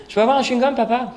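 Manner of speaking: put-on child asking. Son asking dad for gum.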